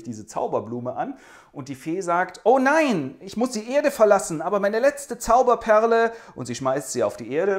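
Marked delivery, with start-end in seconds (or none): 2.4-6.1 high, loud cry of a fairy forced to leave; lamenting fairy. Acted. Fairy crying out that she must leave the earth, high and loud. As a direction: wail it like a tragic fairy queen.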